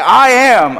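high, loud grand declaration; hammed-up self-proclamation; mock triumphant boast. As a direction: belt it out like a champion. Repeats the bowler's boast.

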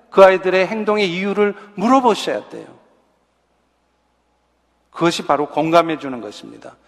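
normal talking voice

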